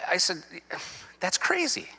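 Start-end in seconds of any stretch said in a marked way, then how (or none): 1.2-1.9 incredulous. Retold or imitated. Retort to the deputy.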